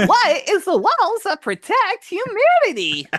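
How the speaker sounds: very high, loud squeak; squeaky high voice. Retold or imitated. Mimics the squeaky voice.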